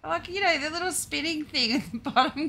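high, loud incredulous retelling; retold exasperation. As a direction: act out a baffled, amused argument. Retold exasperated exchange about the flower.

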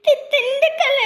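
high, sobbing stammer; crying. Acted. Child answering the watchman about her town, crying. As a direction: stammer it out through sobs. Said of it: "சரி, சரி, அழக்கூடாது".

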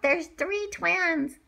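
ordinary delivery, nothing marked

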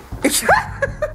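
real high laugh at the friend's remark. Real, not acted. Laughing at friend's teasing, high laugh.

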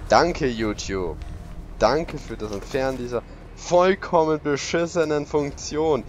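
slow, dripping sarcastic gratitude; drawn-out mock thanks. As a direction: thank them so they know you mean the opposite. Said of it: "Sollte nicht so sarkastisch immer sein".

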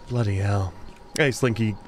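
ordinary talk

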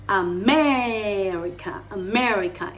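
"America" with MER stretched long; "America" drawn out on its second syllable; stress on MER. Shown as a demonstration. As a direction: lean hard on the E of "America". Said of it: "hay que meterle la tilde".

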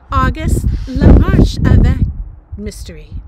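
loud, grandly proclaimed chapter heading; dramatic announcement. A narrator's reading. Reader announcing the diary's first month, loud dramatic announcement. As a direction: proclaim it loudly like a grand title.

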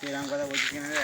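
exasperated protest. Acted. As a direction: demand to know why he talks like this. Friend refuses, exasperated protest.

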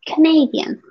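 Canadian said clearly as kuh-NAY-dee-un, with no s at the end; a teacher's firm correction of Canadian; correcting pronunciation. Shown as a demonstration.

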